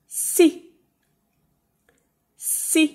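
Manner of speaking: SEA as an isolated word, pitched high; high, careful SEA with a long gap after; slow teaching demo. Shown as a demonstration. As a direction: model SEA for a learner to repeat. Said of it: "Eu vou falar devagar agora".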